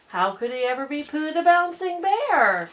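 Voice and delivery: ordinary delivery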